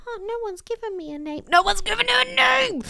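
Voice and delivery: a lamb realising nobody named him; sad lamb voice. Retold or imitated. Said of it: "As soon as they were like".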